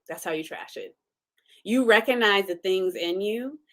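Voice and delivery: ordinary talk, nothing marked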